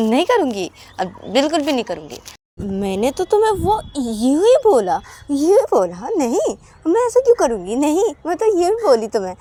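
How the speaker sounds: high, insistent voice swearing she won't do it; coaxing promise. Acted. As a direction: plead innocently, then beg her to come. Payal promises and coaxes.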